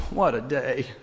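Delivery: soft, deflated sigh of a line; weary groan. Retold or imitated. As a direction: groan it out, worn down. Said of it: "he groans".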